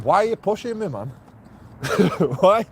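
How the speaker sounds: high, affronted protest; indignant retort. Retold or imitated. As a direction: pitch it up, offended and challenging. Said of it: "and going".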